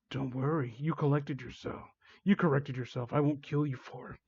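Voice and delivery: quietly sinister, unhurried; menacing reassurance. Acted. Villain forgives victim's slip, softly menacing.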